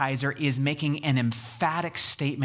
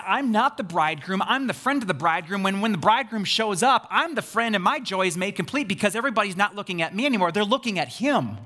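higher, quickening, eager paraphrase; excited deflection; 5 semitones higher, 3 dB louder, faster than usual. Retold or imitated. Preacher voices John as bridegroom's friend, excited deflection. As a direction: build speed as if deflecting all attention.

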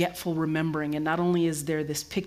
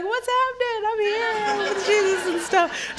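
much higher squeaky comic voice; Holy Spirit as a squeaky excited character; goofy voice; 13 semitones higher than usual. Retold or imitated. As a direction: play a giddy, excited spirit. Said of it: "the Holy Spirit who's just like".

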